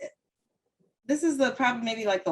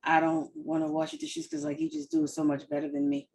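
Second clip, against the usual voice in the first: mock flattery; 5 semitones higher, 4 dB quieter than usual. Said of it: "That sounds like that kind of flattering".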